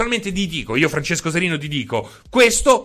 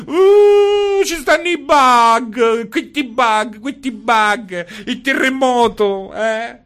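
high, squawky hen-like mock whine, slower in places; whiny gamer mockery; 6 semitones higher, 4 dB louder, much slower than usual. Host mocking gamers who complain about bugs, squawky whine. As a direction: mimic a whining gamer complaining, high and loud. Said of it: "basta quella voce da gallina".

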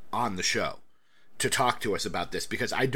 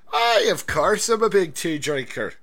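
hammed-up English gent, louder and proud; mock English gent; 5 semitones higher, 6 dB louder than usual. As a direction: play a fake posh Englishman who loves tea. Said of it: "playing the Englishman, mock answer".

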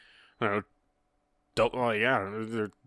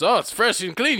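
high, louder silly enthusiasm; goofy excitement; 10 semitones higher, 4 dB louder than usual. Retold or imitated. Pate showing off the urinal cake. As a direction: sell it like a happy fool. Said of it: "And he goes".

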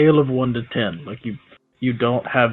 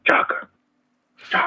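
whisper yelling. Pretend. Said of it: "I'm kinda whisper yelling".

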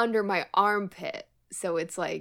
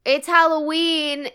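drawn-out announcement; 8 semitones higher, 7 dB louder than usual. Pretend. Echoing the joke line.

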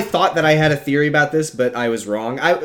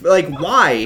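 ordinary talk

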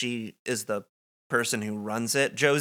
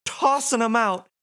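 Nadine voice; 11 semitones higher than usual. Acted. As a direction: build up to a high excited reveal. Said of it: "she says".

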